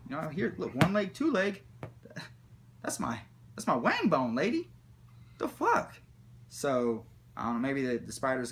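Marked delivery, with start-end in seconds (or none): 0.7-5.9 climbing into a high-pitched what-the; mock outrage. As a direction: act out indignant disbelief at the nurse. Showing his leg to the nurse.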